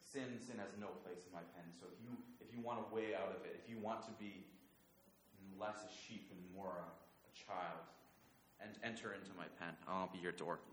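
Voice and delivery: hushed, tender reassurance; gentle invitation. Acted. Jesus inviting a child into his pen, softly. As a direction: invite them in softly, without pressing.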